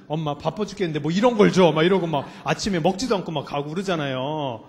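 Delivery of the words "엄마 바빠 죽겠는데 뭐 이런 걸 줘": imitating a grumpy child. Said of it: "심지어는".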